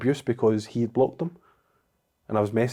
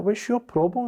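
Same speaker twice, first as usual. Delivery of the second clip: much higher, mocking take on the drunk troll; 11 semitones higher than usual. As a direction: ape the drunk ex-worker's jibe. Quoting drunk ex-employee troll.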